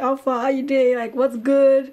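light Nigerian pidgin, loud and casual; Nigerian pidgin greeting. Retold or imitated. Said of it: "Nigerian pidgin".

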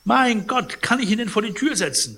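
high, loud, hurried exclamation; exclaiming housekeeper. A narrator's reading. Housekeeper covers for the stranger before the patient, high exclamation.

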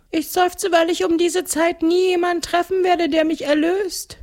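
very high, plaintive ghost voice; sad ghost. A narrator's reading. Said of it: "meint das Gespenst traurig". (trauriges Gespenst mit hoher Stimme)

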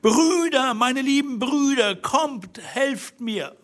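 high, drawn-out cry for help; calling out loudly. A narrator's reading. Wife at the window to her brothers, loud high cry. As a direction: call out desperately, very high. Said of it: "rief, so laut sie konnte".

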